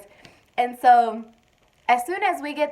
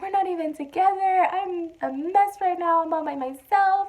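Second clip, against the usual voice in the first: crying; 7 semitones higher than usual. Retold or imitated. Newly pregnant woman on a break from husband, mock crying. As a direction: imitate a whiny, self-pitying cry. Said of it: "I started crying".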